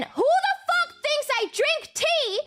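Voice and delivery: high indignant squeal; mock outraged. Streamer reads her angry tweet aloud, high mock outrage. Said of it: "And then".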